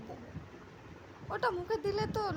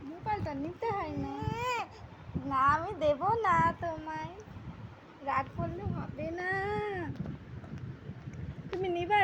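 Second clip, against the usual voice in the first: put-on coaxing singsong baby talk to a toddler. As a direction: cajole the little girl gently. (শিশুকে আদুরে সুরে ভোলানো)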